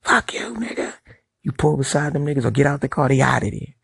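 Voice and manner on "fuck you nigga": high mock yell of an angry driver; mock road rage. Imitating a driver cursing in traffic, high mock yell. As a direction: squeak out the insult, pitched way up. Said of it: "a dude be like".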